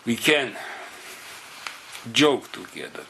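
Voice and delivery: ordinary talk, nothing marked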